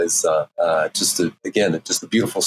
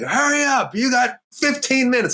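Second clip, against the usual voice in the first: high-pitched mock yell of a slave-driving wrangler; hammed-up, high herding call; bossy wrangler. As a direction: imitate a taskmaster hurrying everyone along. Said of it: "And this lady's like".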